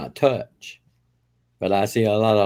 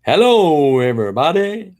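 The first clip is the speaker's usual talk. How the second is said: loud, bright announcer greeting; impression of Wes; 5 semitones higher, 5 dB louder than usual. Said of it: "says you're good at doing Wes".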